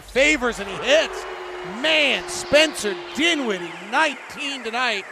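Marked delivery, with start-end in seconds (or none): 1.8-5.0 excited praise. Real, not acted. Praising hot shooter.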